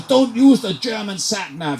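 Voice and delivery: high-pitched attempt at a Brazilian accent; bad Brazilian accent. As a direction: poorly imitate a Brazilian, pitched right up. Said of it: "I can't do a Brazilian accent".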